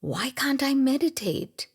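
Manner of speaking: acted-out frustrated thought; frustrated wondering. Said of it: "In frustration, you wonder".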